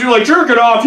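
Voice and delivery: usual speaking voice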